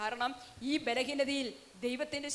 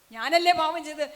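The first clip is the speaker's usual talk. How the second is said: ഞാനല്ലേ pushed out much louder the second time; the woman presses the weeping priest, louder; insistent repeat; 3 semitones higher, 10 dB louder than usual. Retold or imitated. Penitent woman asks the priest.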